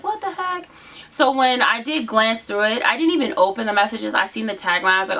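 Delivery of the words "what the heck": high, soft disbelief; a baffled what the heck; surprised. Retold or imitated. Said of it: "I'm just like".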